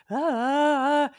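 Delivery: loud, drawn-out cry of panic; panicked scream. Retold or imitated. Acts out sudden panic in café.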